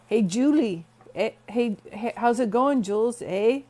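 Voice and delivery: light imitation of a Canadian accent; Canadian accent impression. Mimicking Canadian friends' greeting in a light Canadian accent. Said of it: "Like, they would talk like that".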